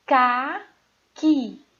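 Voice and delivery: "caqui" split into two loud, separate syllables. Shown as a demonstration. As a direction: read out "caqui" loudly in slow pieces for a class. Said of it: "Então, a primeira palavra".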